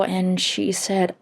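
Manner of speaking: normal talking voice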